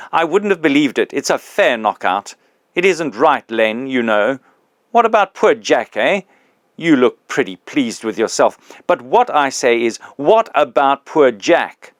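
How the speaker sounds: put-on the big ghost confronting his old employee, loud and aggrieved; indignant big ghost.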